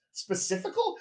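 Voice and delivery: high rising disbelief at his own word; amused disbelief at a made-up word; surprised question. Real, not acted. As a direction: pitch way up as if hearing it fresh. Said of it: "it came out specifical".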